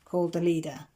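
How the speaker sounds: usual speaking voice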